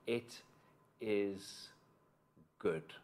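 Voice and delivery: soft, low, very spaced-out words; God's gentle blessing. Acted. God speaks to his young creation, softly blessing it. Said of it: "the first thing God says to it".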